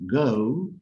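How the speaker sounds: "go" said slowly with a round o; English go. Shown as a demonstration. Teacher showing students the o in "go". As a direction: draw out "go" clearly. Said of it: "El sonido de la O es O".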